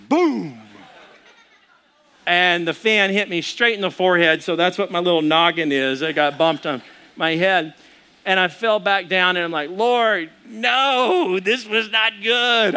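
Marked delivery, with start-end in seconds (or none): 0.1-0.6 drawn-out, falling boom; imitated impact boom. Man sits up into ceiling fan, comic boom. 9.7-12.8 mock lament. Man struck by fan cries to God, mock lament.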